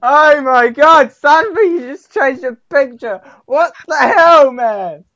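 high, loud mock astonishment; exaggerated yelling disbelief; over-the-top shocked reaction. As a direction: ham up a huge shocked reaction. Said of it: "give me some good reactions".

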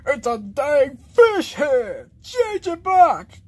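loud, slow, emphatic outraged shout; angry yelling. Acted. Demanding his head be changed back, angry yell. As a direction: yell each word out in outrage.

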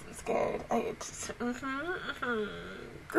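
scared, whispered under breath; real whisper. Real, not acted. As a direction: whisper it like you can barely cope. Reacting to emotional show.

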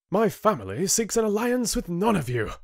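high-pitched, indignant declaration; angry refusal. Acted. Rin rejecting the council's alliance, angry. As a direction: refuse them all in fury.

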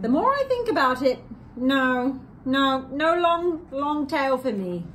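lively storybook read-aloud; reading to children. A narrator's reading. Two hosts reading Seuss to kids, lively storybook voice. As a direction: read it like a bedtime picture book.

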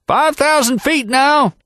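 put-on old mountain guide shouting ahead to the rider; old packer's yell. A narrator's reading. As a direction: call it back from the saddle, drawn out. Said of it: "yelled Happy from up front".

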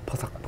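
아주 작게 속삭이는 바삭 소리; 씹는 소리를 작게 따라 해. Real, not acted. Imitating salad crunch softly. (속삭이듯 파삭 소리 흉내)

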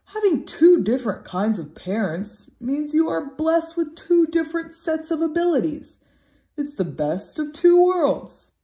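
father dog proudly encouraging his pup; proud dad. A narrator's reading. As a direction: cheer him up with pride. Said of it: "answered Axel".

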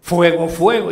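loud retold cry of alarm; retold shout. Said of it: "porque gritó".

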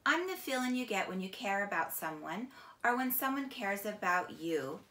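ordinary delivery

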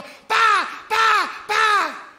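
three loud, high shouted whacks; imitated blows. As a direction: punch out each hit as a separate yell. Imitates three rifle-butt blows.

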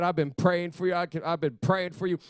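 ordinary talk

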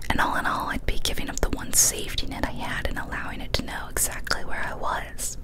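usual speaking voice

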